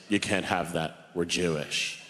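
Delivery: a hint of a stern old grandfather. Grandfather forbidding pizza to grandson. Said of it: "and he said".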